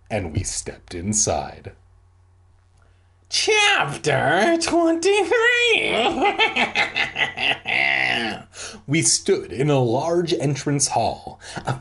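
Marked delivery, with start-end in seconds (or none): 3.3-5.9 very high, drawn-out ghoulish chapter title; spooky announcer. Acted. 6.0-8.8 long put-on ghoulish cackle; spooky cackle. Spooky cackle after chapter title.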